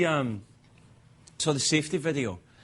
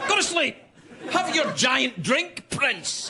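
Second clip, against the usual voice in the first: high, hammed-up yelled order; exasperated father; 9 semitones higher, slower than usual. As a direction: order the kid to sleep, mock fury. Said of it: "We had our first argument".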